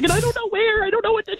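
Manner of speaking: high, panicky whine; mock panic in a high voice; panicked. Retelling panic, pitch way up.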